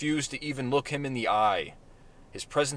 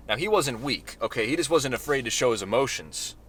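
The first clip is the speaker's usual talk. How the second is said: ordinary delivery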